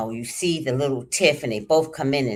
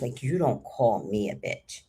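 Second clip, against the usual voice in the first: normal talking voice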